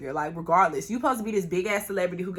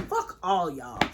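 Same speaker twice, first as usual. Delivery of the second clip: lower, muttered "Fuck all y'all"; dismissive; 7 semitones lower, slower than usual. Retold or imitated. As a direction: drop "Fuck all y'all" down low, done with it. Quitting the fight.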